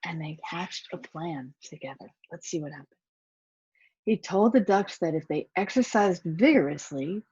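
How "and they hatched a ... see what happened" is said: much softer, confiding; hushed aside. Real, not acted. Reader lowers voice to the children.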